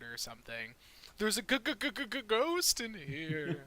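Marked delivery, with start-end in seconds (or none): 1.2-3.6 high, hammed-up cartoon stutter; Scooby-Doo level scared. Game master as Halifax warning of a ghost, cartoonishly scared. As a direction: stammer the g over and over in mock terror. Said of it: "like, Scooby-Doo level of, like, scared".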